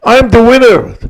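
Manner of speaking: high, emphatic cry of triumph; retold yell. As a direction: call it out high to everyone. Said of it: "when he yelled out".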